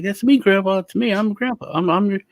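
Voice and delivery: high, earnest pleading; mock pleading grandson. Grandson pleads with grandpa to recognise him, high voice. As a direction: insist gently that it's really you. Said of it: "I was like".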